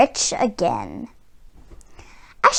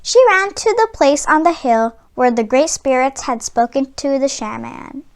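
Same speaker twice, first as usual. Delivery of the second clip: usual speaking voice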